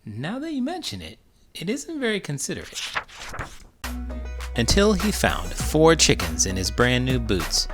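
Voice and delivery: ordinary delivery, nothing marked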